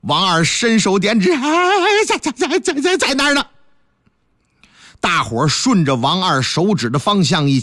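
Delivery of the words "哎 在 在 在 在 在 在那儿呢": terrified stammer. Acted. Terrified constable stammering at ghost.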